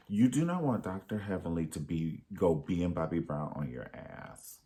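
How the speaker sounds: usual speaking voice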